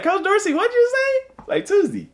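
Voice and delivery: high mock kid voice asking what he said; mocking students. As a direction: imitate his students ribbing Coach Dorsey. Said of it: "my students laugh at how I say that".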